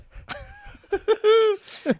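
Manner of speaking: big shrieking laugh; loud high laugh. Real, not acted. Big laugh after rhyme.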